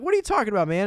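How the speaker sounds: high-pitched, agitated retelling; mock panic, pitch jumping way up; freaking out. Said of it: "he starts freaking out".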